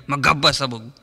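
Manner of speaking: ordinary delivery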